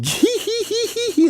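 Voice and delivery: tiny high mocking hee-hee laugh; tittering laugh. Sparrows laugh at fallen Ollie, high squeaky tittering. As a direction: giggle at him in a squeaky bird voice. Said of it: "laughing at him".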